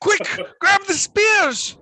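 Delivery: high, loud, drawn-out shriek; panicked screaming. Acted. Villagers rallying against the returning attacker, screaming in panic. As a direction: scream a desperate call to arms. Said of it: "you hear human sounds screaming".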